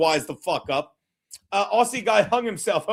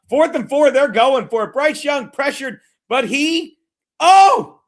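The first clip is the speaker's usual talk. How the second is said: louder, higher sportscaster call of the live play; play-by-play commentary; 5 semitones higher, 5 dB louder than usual. Acted. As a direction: call it like a TV announcer, excited and loud. Mock play-by-play call of the game.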